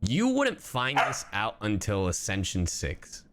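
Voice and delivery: usual speaking voice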